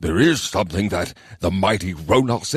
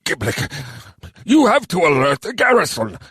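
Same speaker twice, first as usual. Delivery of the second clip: grumbled order, pitched up and forceful; grumbling; 11 semitones higher than usual. A narrator's reading. Mayor ordering his adviser to the garrison, grumbling. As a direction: grumble urgently at an underling. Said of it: "the mayor grumbled".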